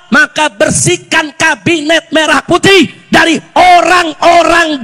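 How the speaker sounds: impassioned preaching. Real, not acted. Preacher addressing the President before a crowd, impassioned and forceful. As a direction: drive each demand home, building to a shout.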